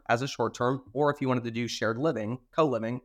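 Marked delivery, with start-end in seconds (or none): none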